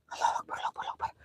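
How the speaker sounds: voiceless frightened prayer; hushed prayer in fear; whispered prayer. Real, not acted. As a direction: whisper the prayer to calm yourself. Praying in fear at night.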